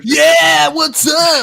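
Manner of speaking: loud, high-pitched whoop of greeting; hyped cheer. Real, not acted. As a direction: shout it high to fire everyone up. Hyping up a friend on stream.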